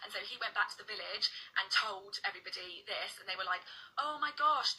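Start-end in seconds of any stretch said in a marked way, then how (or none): 4.0-4.7 retold amazement. Villagers react to fisherman's tale.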